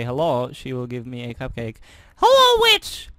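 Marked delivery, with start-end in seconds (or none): 2.2-3.1 witch voice. Acted. Calling out in witch voice.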